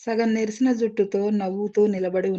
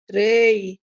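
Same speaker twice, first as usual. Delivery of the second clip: a long, slow, louder roar; thunderous roar; 5 dB louder, much slower than usual. A narrator's reading. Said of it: "భూమి గర్జించినట్లు".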